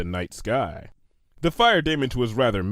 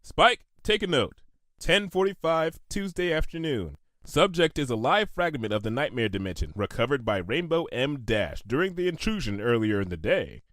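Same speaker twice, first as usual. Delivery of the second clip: dictating notes; 4 semitones higher than usual. A narrator's reading. Twilight dictating lab notes.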